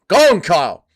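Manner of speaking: mock coach. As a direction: egg him on like a cornerman. Mock coaching a chatter in bed.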